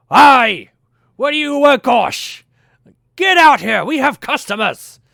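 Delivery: dwarf shouting into the back room; yelling. Acted. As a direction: holler at your brother to come out. Dwarves yelling between rooms.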